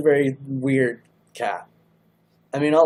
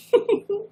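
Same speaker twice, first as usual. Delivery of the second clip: high snorting laugh burst; a sudden snort as she laughs; 4 dB louder than usual. Real, not acted. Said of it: "Oh my God, I snorted".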